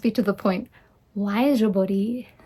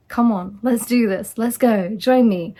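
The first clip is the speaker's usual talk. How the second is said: louder, pumped-up invitation; rallying call; 5 dB louder than usual. Real, not acted. Coach urging viewers to level up, louder rallying call. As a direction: fire the listener up to join in. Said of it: "rallying the listener".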